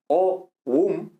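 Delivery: "o, um" in two separate, drawn-out syllables; split into syllables. Shown as a demonstration. Teacher showing where the syllable begins, split into syllables. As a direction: sound out "o", pause, then "um". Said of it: "aquí está al principio de una sílaba".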